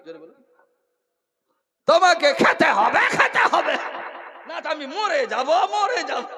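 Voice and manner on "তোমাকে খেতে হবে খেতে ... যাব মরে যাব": high, loud, sobbing plea; girl crying at the idol, high and loud; wailing girl. Acted. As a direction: cry it like a desperate girl.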